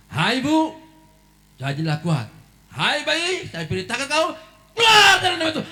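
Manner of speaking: high, loud commanding prayer. Retold or imitated. Preacher commands the birth, rising to loud. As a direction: order the baby out with force.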